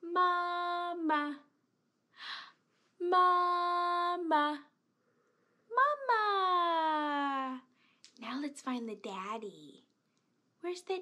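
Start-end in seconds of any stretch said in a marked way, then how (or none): 0.0-7.6 loud, drawn-out call. Shown as a demonstration. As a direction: call out for mama. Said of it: "Let's say".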